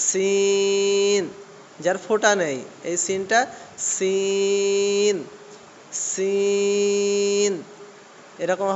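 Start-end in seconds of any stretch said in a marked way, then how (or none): 0.1-1.2 সিন with a long whistling s; hissing sin. Shown as a demonstration. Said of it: "একটু সিটির মতো আওয়াজ হবে". 3.9-7.5 সিন with a long whistling s; hissing sin. Shown as a demonstration. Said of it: "একটু সিটির মতো আওয়াজ হবে".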